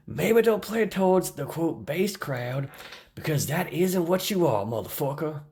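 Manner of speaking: high-pitched mock Southern drawl; funny Southern voice. Reading a critic's comment aloud, funny Southern voice. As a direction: read it out in a silly, high Southern accent. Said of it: "I'm gonna do a funny voice".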